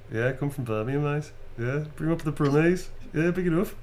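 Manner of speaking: broad, bantering Brummie; big up the Brummie vowels; original Birmingham accent. Shown as a demonstration. Showing off the original accent, broad Brummie. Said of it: "This is my accent".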